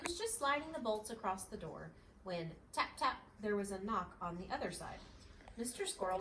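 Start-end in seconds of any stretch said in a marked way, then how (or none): none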